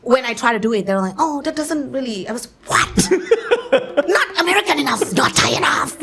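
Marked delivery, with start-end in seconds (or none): 1.2-2.3 high, hesitant mock rejection; twijfelende afwijzing. Voices doubtful casting people. 2.7-6.0 high-pitched mock outrage; annoyed. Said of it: "like, annoyed".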